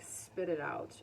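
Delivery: ordinary delivery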